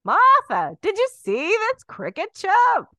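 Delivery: high, loud, slow amazed call; excited old-timer. Retold or imitated. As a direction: call out in wonder to Martha. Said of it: "people would just be staring".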